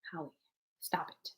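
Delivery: voiceless whispered plea. Real, not acted. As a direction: hiss it under your breath. Teasing Holly after clearance talk.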